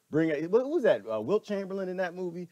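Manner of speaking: ordinary talk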